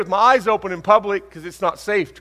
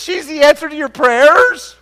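loud, much higher disbelieving question; incredulous; 9 semitones higher, 9 dB louder than usual. Retold or imitated. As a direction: throw it back in disbelief. Incredulous at mother's remark.

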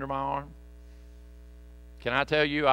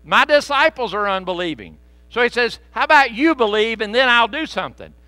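teasing Jesus; 7 semitones higher, 7 dB louder than usual. Retold or imitated. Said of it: "He's messing with this guy".

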